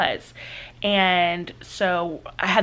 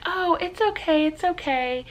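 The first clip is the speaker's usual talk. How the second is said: high, light, easygoing mom voice; reassuring mom; 10 semitones higher than usual. Retold or imitated. Mom to daughter who woke her, reassuring. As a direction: imitate a mother brushing off an apology in a high voice. Mom unusually fine being woken.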